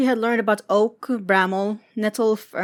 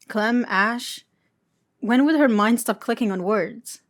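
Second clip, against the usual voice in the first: plain reading voice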